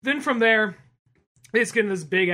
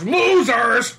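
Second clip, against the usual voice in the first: loud, high drawn-out jeer; mock yell; 7 semitones higher, 11 dB louder than usual. Businessman jeers at coach passengers, mock yelling. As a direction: holler it as a taunting insult. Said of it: "And he literally yells".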